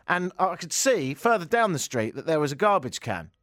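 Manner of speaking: ordinary talk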